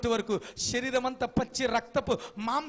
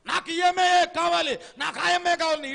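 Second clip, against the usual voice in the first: higher and louder, refusing to listen; loud stubborn insistence; 6 semitones higher, 10 dB louder than usual. Acted. Infatuated son loudly insisting he wants only the girl. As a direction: insist on her, voice raised.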